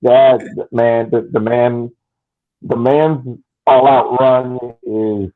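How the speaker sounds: normal talking voice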